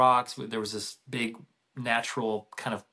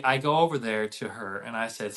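ordinary delivery

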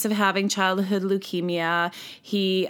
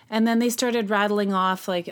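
ordinary delivery

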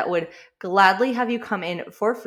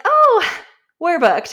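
receptionist voice; 10 semitones higher, 10 dB louder, slower than usual. Retold or imitated. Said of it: "And they're like".

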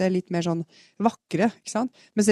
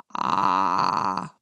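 loose, easy creaky fry with plenty of airflow, much lower; relaxed creak; 11 semitones lower than usual. Shown as a demonstration. Voice coach showing the right, relaxed creak, airy fry. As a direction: let a relaxed creak ride on the breath. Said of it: "kontra en avspent riktig en med mye luftstrøm".

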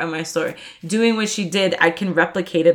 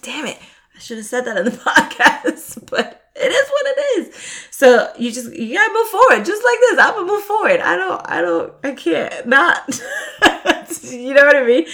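high-pitched giggling through the words; real laughter breaking up the sentences; laughing; 8 semitones higher than usual. Real, not acted. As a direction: laugh at yourself while talking. Laughs at forgetting the word.

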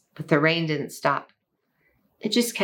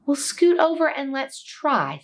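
little mouse voice; 7 semitones higher than usual. Acted. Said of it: "said the mouse".

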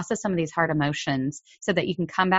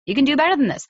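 higher, insistent push; firm demand; 8 semitones higher, 4 dB louder, faster than usual. Shown as a demonstration. Parent to misbehaving child, firm and insistent. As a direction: call them out on it. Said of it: "You demand it of them".

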